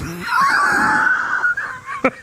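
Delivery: high shrieking laugh; hysterical laugh. Real, not acted. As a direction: burst into helpless shrieking laughter. Cracks up at the post.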